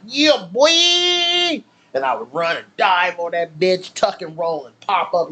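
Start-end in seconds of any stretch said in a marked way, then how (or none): 0.1-1.6 triumphant yell. Retold or imitated. Kid shouting after his playground stunt, triumphant whoop. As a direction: cheer like a kid nailing a stunt.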